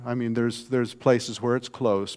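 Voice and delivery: ordinary delivery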